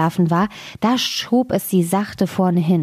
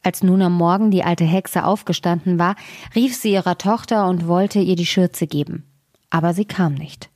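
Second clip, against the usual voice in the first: usual speaking voice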